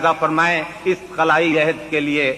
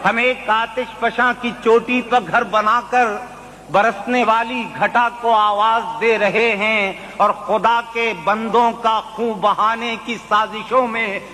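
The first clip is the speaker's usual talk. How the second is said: poetry recitation; 5 semitones higher than usual. Acted. Mushaira recitation.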